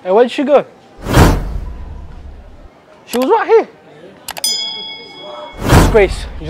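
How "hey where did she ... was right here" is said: ordinary talk, nothing marked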